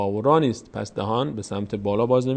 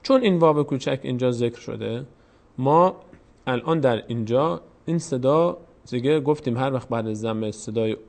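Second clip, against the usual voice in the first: ordinary delivery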